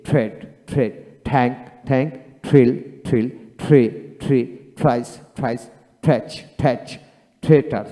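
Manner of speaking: each th word drilled twice with an aspirated থ, as in থার্ড and থিন; teacher modelling th as থ, word by word; th pronounced as Bengali থ, repeated drill. Shown as a demonstration. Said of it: "টিতে থ উচ্চারিত হয় কোথায়?".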